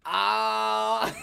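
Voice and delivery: loud, high hammed-up cry; mock shout of pain. Comparing his groan to crashing portfolios, a loud hammed-up cry. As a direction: belt out a crashing-market wail. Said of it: "what people's portfolios was doing".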